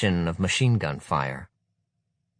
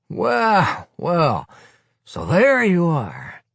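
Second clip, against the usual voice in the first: higher, louder sardonic greeting; mock growl; 9 semitones higher, 5 dB louder, much slower than usual. Willy growling at the leader, sardonic. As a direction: greet him with grim irony. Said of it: "he growls at the leader".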